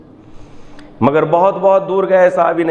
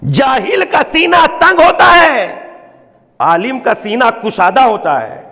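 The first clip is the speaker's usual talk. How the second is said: ordinary delivery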